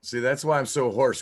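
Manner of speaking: ordinary talk, nothing marked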